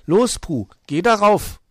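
high, loud urging; bossy command. Acted. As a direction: order him up, loudly and high. Luzi orders Puh up the ladder.

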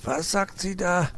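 high, sour muttered grumble; growl. Acted. Uncle fox grumbles at the phone, mock growl. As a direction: grumble from the side. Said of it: "knurrte Herr Fuchs".

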